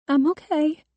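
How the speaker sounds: put-on high, quivering girl's voice. A narrator's reading. Shaken girl reassures her parents, voice quivering. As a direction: try to reassure in a high, unsteady voice. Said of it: "her voice quivering".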